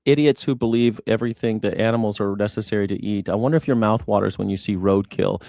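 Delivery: ordinary talk, nothing marked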